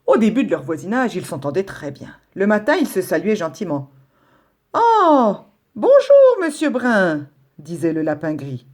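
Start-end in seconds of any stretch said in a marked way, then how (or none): 4.7-7.3 high, bright-voiced little rabbit calling hello; grey rabbit greeting his neighbour, high and cheerful; cheery rabbit greeting. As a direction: sing out a friendly good morning, high and loud.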